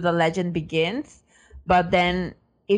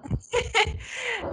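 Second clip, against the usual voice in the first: high laugh; 13 semitones higher, 6 dB quieter than usual. Real, not acted. Laughs at confusion.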